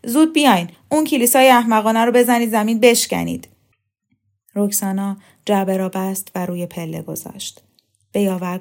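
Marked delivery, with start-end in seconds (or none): 0.0-3.5 loud, urgent order; shouting. A narrator's reading. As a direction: yell commands at the others. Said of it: "دانشجو داد زد". (با داد و فریاد)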